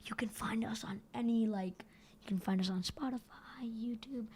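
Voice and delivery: whispered fine print; hushed, unvoiced small-print read; tiny writing. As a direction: whisper it like tiny lettering on a tombstone. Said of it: "mini writing at the bottom".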